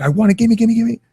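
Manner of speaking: put-on screaming kid. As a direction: play the toddler grabbing for his toy. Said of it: "and he's like".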